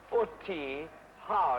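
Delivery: "uti ha" said slowly, stress on "uti"; Latin pronunciation. Shown as a demonstration. Director correcting an actor's Latin, careful demo. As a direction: spell out "uti ha" slowly and clearly. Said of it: "remember, not uti to use".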